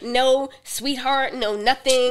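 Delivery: slow, laughing "sweetheart"; mock sweetness. Partner mimics sugary pet names, laughing. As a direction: draw it out sweetly, half laughing. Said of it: "she was like".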